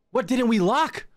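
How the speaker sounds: high, exasperated question, rising at the end; frantic question. Real, not acted. Player trapped after locking everything, high frantic question.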